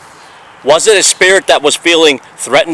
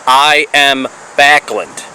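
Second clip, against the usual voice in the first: normal talking voice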